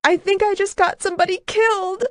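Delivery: high, fast, half-sobbed; woman seeing the driver shot, high and fast; sobbing. A narrator's reading. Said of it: "She sobbed".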